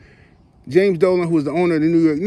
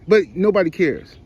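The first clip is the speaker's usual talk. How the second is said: usual speaking voice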